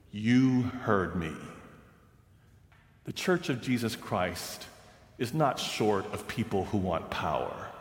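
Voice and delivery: hushed, weighty elder; solemn quiet rebuke. Retold or imitated. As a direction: let the truth land quietly. Old clerk's solemn rebuke.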